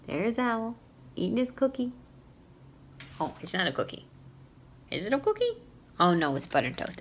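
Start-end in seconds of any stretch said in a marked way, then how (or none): 4.9-5.6 high, surprised question; mock surprised question. Real, not acted. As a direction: pretend to wonder. Teacher pretends to wonder.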